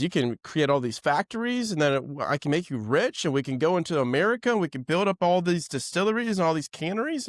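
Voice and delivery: imitated businessman's high, excited pitch; eager sales pitch. Businessman pitches big plans to fisherman.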